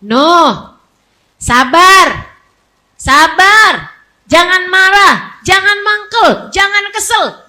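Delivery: loud, emphatic string of commands; forceful command. Acted. God commanding Nuh to be patient, loud and firm. God's stern command to Noah, acted.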